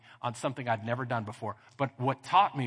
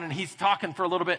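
ordinary talk

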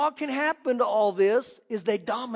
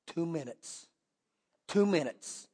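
normal talking voice

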